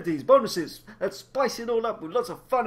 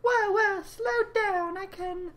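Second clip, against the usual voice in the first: high villain voice; 12 semitones higher, slower than usual. Acted.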